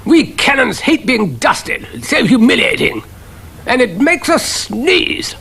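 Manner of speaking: put-on high, slow pompous old officer; affronted cannon. A narrator's reading. Cannon complaining about dusting, pompous. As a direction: complain with wounded dignity.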